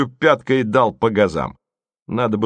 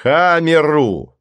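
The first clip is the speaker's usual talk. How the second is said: громкий протяжный командный крик; крикни приказ во весь голос, растягивая; 5 semitones higher, 5 dB louder, much slower than usual. A narrator's reading. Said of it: "закричал Голубович". (громко, повелительно закричать)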